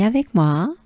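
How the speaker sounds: ordinary talk, nothing marked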